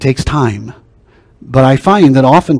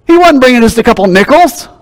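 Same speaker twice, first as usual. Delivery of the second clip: higher, louder pulpit fervour; preaching at full force; 10 semitones higher, 5 dB louder than usual. Real, not acted. Preacher rousing the congregation, loud and high. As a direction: drive it home to the pews.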